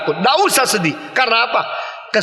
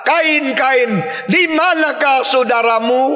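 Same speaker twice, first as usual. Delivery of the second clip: God calling Cain; 5 semitones higher, slower than usual. Acted. God calling Cain to account, raised and slow. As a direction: call out to Cain, demanding an answer.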